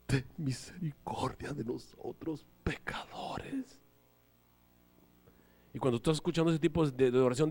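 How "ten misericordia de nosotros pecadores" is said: low, slow, soft pious prayer voice, mocking; put-on prayer voice. Preacher mimics showy praying to the congregation, low and slow. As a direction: slowly put on a solemn holier-than-thou prayer tone. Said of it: "cambian la impostación de la voz".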